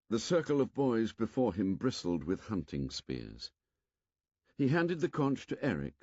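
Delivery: ordinary delivery, nothing marked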